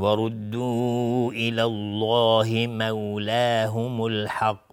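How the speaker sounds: slow, drawn-out recitation with long vowels; Quran recitation. Shown as a demonstration. Teacher reciting the verse being taught, slow Quran recitation.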